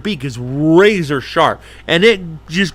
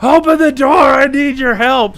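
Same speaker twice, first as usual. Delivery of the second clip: desperate mock yelling; pleading yell; 10 semitones higher, 5 dB louder than usual. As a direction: shout for help outside. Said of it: "I'm like".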